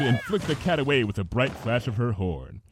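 plain reading voice

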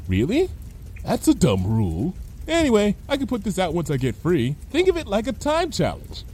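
lightly voiced carefree character; Lyra, flippant. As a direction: shrug off the objection. Lyra shrugs off objection.

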